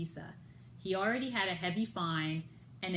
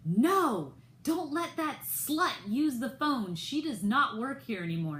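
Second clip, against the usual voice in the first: retold scream; 5 semitones higher, 4 dB louder than usual. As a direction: bark it across the lobby in mock rage. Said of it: "screamed out at the hotel desk".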